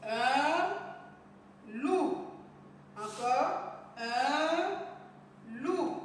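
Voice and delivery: "un ... loup" drawn out, word by word; modelled reading aloud. Shown as a demonstration. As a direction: stretch "un" on a rise, pause, then land "loup". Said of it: "Nous lisons ensemble".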